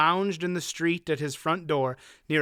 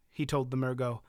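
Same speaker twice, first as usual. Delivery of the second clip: plain reading voice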